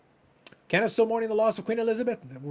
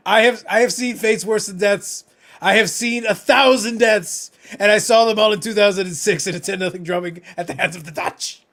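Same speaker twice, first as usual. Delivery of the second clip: mock epic; 3 semitones higher, 8 dB louder than usual. Recounting a sports loss to the chat like an old war, hammed up. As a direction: play a battle-scarred veteran, half-joking.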